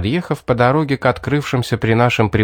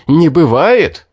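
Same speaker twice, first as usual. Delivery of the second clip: much louder, higher furious yell; furious shout; 5 semitones higher, 7 dB louder, slower than usual. A narrator's reading. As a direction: yell it back, choking with rage. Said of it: "задохнулся от ярости".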